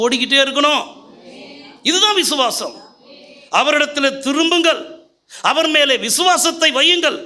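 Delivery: rally the congregation with rising fervor; impassioned Tamil pulpit exhortation; preaching at full force. Acted.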